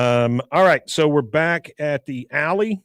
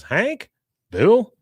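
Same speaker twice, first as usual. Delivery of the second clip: high, rising startled name; mock surprise, pitch jumping up; surprised questioning; 10 semitones higher, 5 dB louder than usual. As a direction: act out spotting someone unexpected. Startled recognition between two acted characters.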